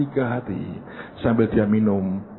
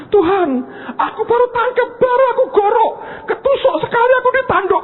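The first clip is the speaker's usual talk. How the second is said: much higher, louder wailing woman; frantic Marta; 4 dB louder than usual. Retold or imitated. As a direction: gush out the mishap in a frantic high voice. Marta's frantic goat story. (Marta panik, suara melengking tinggi)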